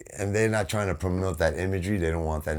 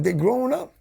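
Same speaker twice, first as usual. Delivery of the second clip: mock proud. Quoting own approving thought.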